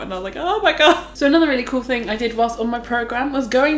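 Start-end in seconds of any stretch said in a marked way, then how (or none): 0.4-1.2 high-pitched mock alarm; retold freak-out. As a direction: act out a freaked-out exclamation. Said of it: "so she gets really freaked out".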